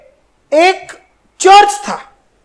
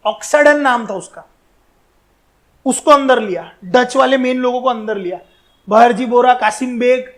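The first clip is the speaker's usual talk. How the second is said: ordinary talk, nothing marked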